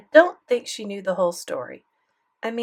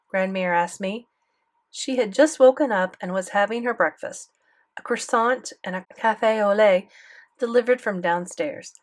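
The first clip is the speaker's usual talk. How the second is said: ordinary delivery, nothing marked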